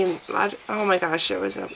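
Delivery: ordinary talk, nothing marked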